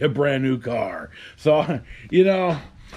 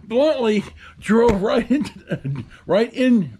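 laughing while telling; 6 semitones higher, slower than usual. Real, not acted. Laughing at the car crash.

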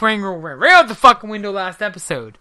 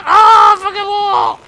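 higher, loud curse at the wall; frustrated shout; 10 semitones higher, 7 dB louder, slower than usual. Real, not acted. Gamer stuck on a wall, frustrated shout. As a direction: yell it in exasperation. Blocked by wall mid-fight.

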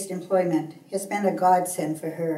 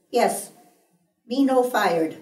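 higher, louder quoted voice of a daughter; daughter's reply; 4 semitones higher, 4 dB louder, slower than usual. A narrator's reading. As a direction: voice your daughter's emphatic answer, pitched up. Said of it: "she says".

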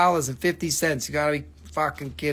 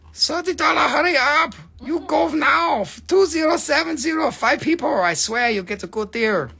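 put-on very high, heavily accented street seller; high-pitched hawker; 10 semitones higher than usual. Seller rushing buyers on a deal, high-pitched accented hawker.

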